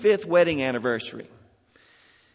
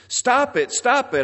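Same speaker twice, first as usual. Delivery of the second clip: higher, louder repeated plea; mock cry out; 9 semitones higher, 5 dB louder than usual. Teacup begging its master amid paint fumes, mock cry out. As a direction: call out urgently, as a storyteller acting it. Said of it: "I cried".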